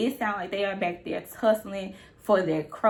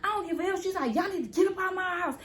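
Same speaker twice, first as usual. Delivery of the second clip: much higher, shouting him out; girlfriend's high yell; 10 semitones higher, faster than usual. Retold or imitated. Girlfriend ordering everyone out of her house, high shout. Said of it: "She said".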